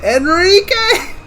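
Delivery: Enrique! called out, drawn out; startled cry of a name. Acted. Reacting as the prince steps in, startled call. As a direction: call his name in alarm.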